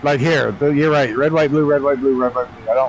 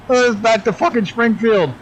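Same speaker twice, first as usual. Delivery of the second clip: can't believe the mistake, voice pitched up; exasperated disbelief; 10 semitones higher than usual. Real, not acted. Streamer realises he flew wrong way.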